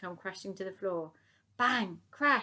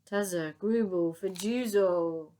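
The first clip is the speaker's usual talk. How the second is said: slow, drawn-out gurgling sounds; tummy rumble noises; 6 dB louder, slower than usual. Acted. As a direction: stretch it like a rumbling belly.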